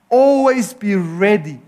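usual speaking voice

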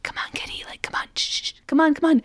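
hushed, half-whispered cajoling; calm coaxing. Retold or imitated. Trying to get Lucifer to come out, calm hushed coaxing. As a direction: coax a cat very softly, shushing. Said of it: "she starts very calmly".

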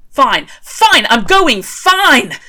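loud, high grudging surrender; exasperated shout. A narrator's reading. Crawley giving in to the cloud, exasperated shout. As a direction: yell it while backing off. Retreating from lightning bolts.

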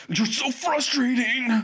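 high, drawn-out imitated yell; mock exasperated yell. Bad boy yelling at Korra, hammed-up exasperation. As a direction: yell it in mock frustration, stretching the words. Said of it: "just yells at her and says".

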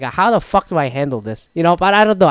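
ordinary talk, nothing marked

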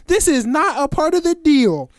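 high, loud mock-outraged woman; wilding girl. Girl argues with the man about their deal, high mock outrage. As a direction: protest in an imitated high female voice. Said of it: "This girl gets to wild".

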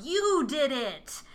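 mock gasped accusation. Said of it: "gasps out".

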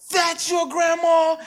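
high, slow, amazed question; surprised kid voice. Retold or imitated. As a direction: kid asking in disbelief. Schoolkids asking about grandma.

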